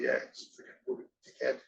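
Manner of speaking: dry low scoff, quick and curt; deadpan put-down. Retold or imitated. Replying to his mates' messages with a low scoff. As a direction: dismiss him flatly, low and fast.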